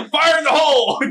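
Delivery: high, loud call of fire in the hole; shouting. Pretend. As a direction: yell the warning like a blaster. Mock demolition warning.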